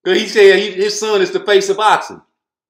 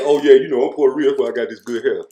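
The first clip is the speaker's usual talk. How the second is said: lower, smug boastful mimicry; lightly voiced smug boxer showing off; a hint of a bragging mock voice; mocking Shakur; 7 semitones lower, much faster than usual.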